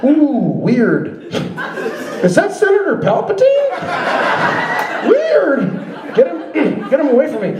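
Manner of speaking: high, amused gawking at a newcomer; mock-surprised high voice, then fast shooing; excited gawking. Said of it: "and they're like".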